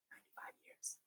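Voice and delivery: hushed awed whisper. Acted. Teacher whispering in awe to students about counting. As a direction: breathe it out in awe.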